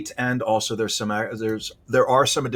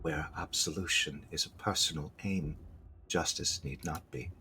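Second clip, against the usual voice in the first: low, very soft spirit voice; quiet, haunting spectral delivery; meek ghostly tone; 7 semitones lower, 13 dB quieter than usual. Acted. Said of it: "It speaks in a meek, ghostly tone".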